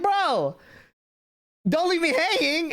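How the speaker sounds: high, pleading bro. Retold or imitated. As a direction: beg him not to leave you hanging. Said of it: "And I'm like".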